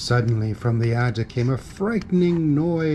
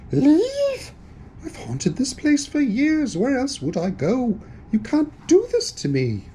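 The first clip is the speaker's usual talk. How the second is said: high-pitched, indignant wail; outraged protest; 11 semitones higher than usual. Acted. Ghost told to leave his house, outraged protest. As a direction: protest high, starting from a shrieked first word.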